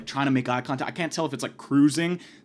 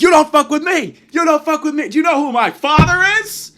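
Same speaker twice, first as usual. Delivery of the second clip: higher, loud furious yell; heated shouting; 14 semitones higher, 10 dB louder than usual. Retold or imitated. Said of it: "we got a little bit heated".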